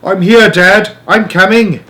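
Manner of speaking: loud, high, eager shout; boy answering his father's call; excited call. A narrator's reading. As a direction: call back, running to him. Boy answers father across woods.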